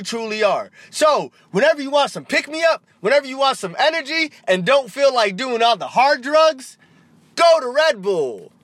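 high, hammed-up commercial pitch; mock ad read. Host plugs Red Bull in a fake commercial, over-the-top pitchman voice. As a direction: sell it in an exaggerated sing-out voice, stretching the final slogans.

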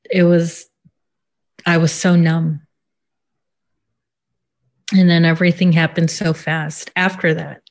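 usual speaking voice

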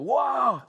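sharp high "Wah!" yelled out loud; shout into a cave. Shown as a demonstration. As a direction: call out as if to hear the echo. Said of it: "you ever shouted in a cave?".

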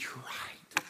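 hushed, sheepish whisper; ashamed whisper. Acted. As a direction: admit it under your breath. Man ashamed, head lowered.

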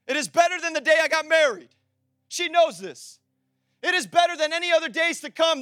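emphatic preacher, punching out each phrase; full-force preaching. Real, not acted. Preacher testifying about being found by Jesus, full-force preaching.

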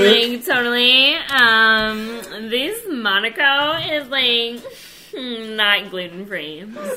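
put-on a ditzy valley girl, totally. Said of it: "Speak like a valley girl".

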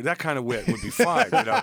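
loud, real laughter through the words; laughing. Real, not acted. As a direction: talk while cracking up at the joke. Cracking up at the announcer impression.

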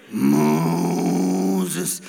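very deep, drawn-out booming call; voice of God. Retold or imitated. God calling Moses in the cinema, very deep and rolling. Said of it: "¿Habéis visto la película?".

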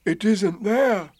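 deliberate, puzzled announcement; Pooh. A narrator's reading. Pooh telling Eeyore about his tail, puzzled.

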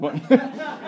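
loud, high burst of real laughter; laughing hard at his own joke. Real, not acted. Preacher cracks up at Thomas joke, laughing loudly.

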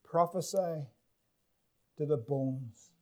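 low, hushed voice of the Lord; hushed, weighty "Prophesy to the bones"; hushed emphasis. Shown as a demonstration. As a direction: drop low and soft for God's command. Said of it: "here's the emphasis".

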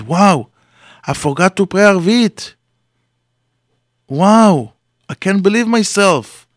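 alarmed, can't believe he forgot; startled dismay. Acted. A man realizing he skipped evening prayer, high and alarmed.

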